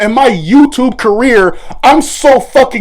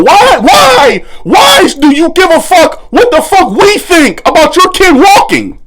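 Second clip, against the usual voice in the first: furious shouting; 9 semitones higher than usual. Real, not acted. Creator yelling at mother about her post, furious. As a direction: demand an answer at full volume.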